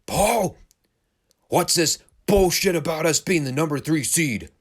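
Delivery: a mock wrestler yelling at his manager; Animal's angry rant. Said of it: "Animal gets on the mic and he goes".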